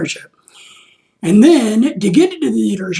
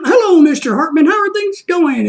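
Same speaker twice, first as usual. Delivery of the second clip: mock cheery adjuster, pitched higher; chipper greeting; 7 semitones higher than usual. Adjuster calling back an irritated customer, mock cheery. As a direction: play the overly happy guy on the phone. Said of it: "don't act like a happy guy".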